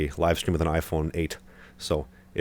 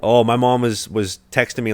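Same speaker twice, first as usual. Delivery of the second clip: ordinary delivery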